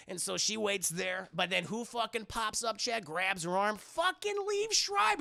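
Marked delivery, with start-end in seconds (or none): 3.8-5.2 high, slow dramatic reveal; mock-shocked, high and slow announcement. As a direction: drag the name out like a shock twist.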